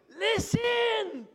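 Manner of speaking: high, drawn-out mock shout; imagined divine shout. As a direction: bellow it as an exasperated God might. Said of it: "Or did he say".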